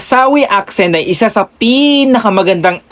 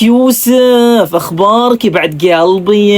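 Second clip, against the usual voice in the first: sweet Hasawi accent; 3 semitones higher, slower than usual. Shown as a demonstration. Vlogger demonstrating how Hasawis greet a friend, sweet and smooth. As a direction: sweet-talk Yusuf in a drawn-out Hasawi lilt. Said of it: "smooth at napaka-sweet ng kanilang mga accent".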